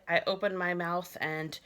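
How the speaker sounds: usual speaking voice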